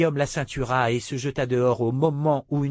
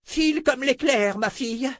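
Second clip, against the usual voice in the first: high, urgent call to the mare; 13 semitones higher than usual. Acted. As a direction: urge the horse on. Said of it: "cria-t-il à l'animal".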